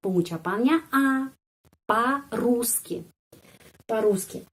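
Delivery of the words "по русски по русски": По-русски stretched slowly with the stress on ру; very slow demo. Shown as a demonstration. Teacher repeating the word slowly for students. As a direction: drag out По-русски, leaning on ру. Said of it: "pada huruf U".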